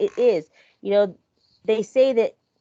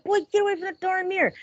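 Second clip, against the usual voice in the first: higher, sharp scolding call; aunt scolding nephew at the mirror, raised pitch; 10 semitones higher than usual. Retold or imitated. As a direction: snap at the boy to get away. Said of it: "I'd tell him".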